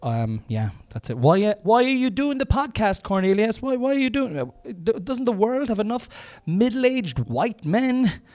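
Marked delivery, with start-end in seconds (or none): 1.2-8.2 high, loud heckler voice; shrieked challenge from an inner critic; shouting alter ego. Acted. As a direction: shout the question in a high put-on voice. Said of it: "to shout".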